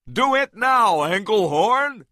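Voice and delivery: high, loud urgent yell; shouted. A narrator's reading. Carl urging Engelhorn on, a high loud shout. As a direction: yell the order across the fight. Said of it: "shouted Carl".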